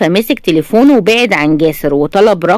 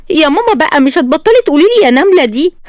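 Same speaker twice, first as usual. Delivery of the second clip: put-on higher, whiny childlike protest; childish annoyance; 8 semitones higher than usual. A narrator's reading. Bride pouting at her mother-in-law's nickname. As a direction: pout it like a sulky little girl. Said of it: "زمت شفايفها بضيق طفولي".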